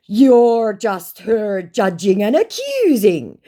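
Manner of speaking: high, loud, slow complaint; exaggerated accusing scammer voice; mock scammer. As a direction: stretch it out as a wronged scammer. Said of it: "said my scammer".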